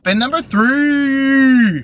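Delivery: slow hammed-up spin announcement; game show announcer.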